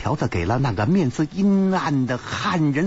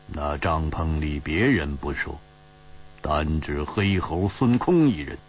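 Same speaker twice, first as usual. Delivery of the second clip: low, cutting sneer, lower voice; cold sneer; 8 semitones lower than usual. Acted. Ji Bingyan sneers at the spy's boast, cold and low. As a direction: scorn him from deep down. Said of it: "冷笑着说".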